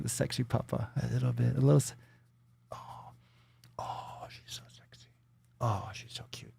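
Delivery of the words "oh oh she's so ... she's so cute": soft cooing whisper. Real, not acted. Cooing over the dog.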